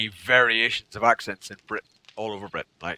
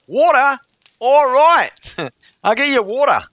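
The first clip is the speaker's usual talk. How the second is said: high, loud mock-Australian accent; Australian waitress; 9 semitones higher, 5 dB louder than usual. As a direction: imitate an Australian waitress cheerfully taking the order, pitched up. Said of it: "when she finally got it".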